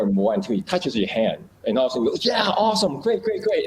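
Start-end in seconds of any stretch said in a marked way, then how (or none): 2.3-3.7 excited praise. Retold or imitated. Praising the dog for a trick.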